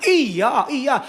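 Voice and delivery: ordinary delivery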